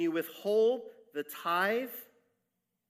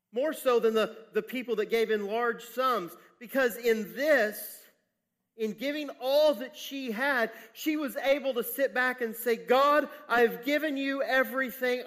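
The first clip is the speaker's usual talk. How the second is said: loud, high, emphatic preacher delivery; impassioned preaching; 7 semitones higher, 6 dB louder than usual. Real, not acted. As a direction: preach it with swelling conviction, stretching key phrases. Preaches with rising force.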